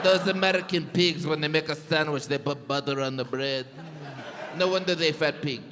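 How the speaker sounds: loosely put-on European accent, mocking Americans; a scornful European accent sneering at American sandwiches. Comedian mocks Americans in a scoffing European accent. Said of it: "That's a good impression of absolutely every European".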